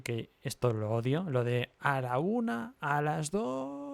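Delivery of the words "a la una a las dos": high, slow stretched-out warning count; teacher counting to three, mock stern and high; mocking countdown teacher. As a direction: drag out the threat like a nagging teacher. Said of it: "O la típica de".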